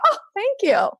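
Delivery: high grateful voice. Retold or imitated. Retelling grateful reply.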